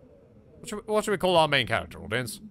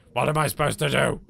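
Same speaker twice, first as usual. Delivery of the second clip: ordinary talk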